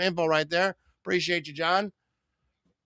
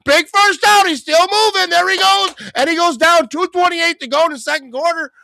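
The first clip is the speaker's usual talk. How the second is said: much higher, louder, shouting the run as it happens; excited play-by-play; 13 semitones higher, 10 dB louder than usual. Real, not acted. Streamer calling a big Seahawks run, shouting excitedly. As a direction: call the breakaway like it's the big moment.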